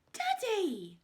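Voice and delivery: high, loud shriek. A narrator's reading. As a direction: shriek at him in shock. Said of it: "shrieked Daisy".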